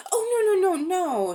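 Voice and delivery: high, loud shocked denial. A narrator's reading. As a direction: recoil at the very idea, voice jumping up. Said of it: "in a shocked voice".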